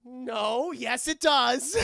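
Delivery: high, loud, drawn-out yes; grudging admission. Retold or imitated. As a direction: Bruce caving in. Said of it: "And Bruce is like".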